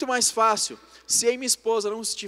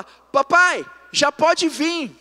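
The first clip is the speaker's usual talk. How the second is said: higher, louder imitation of a little girl; child calling out; 7 semitones higher, 8 dB louder, much slower than usual. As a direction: call to daddy from the next room. Daughter calling father after time-out.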